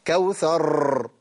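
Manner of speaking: كوثر ending in a long trill; slow كوثر with repeated ر; extra rolled ra's. Shown as a demonstration. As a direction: keep the ر of كوثر rolling. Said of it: "دي راءات زائدة".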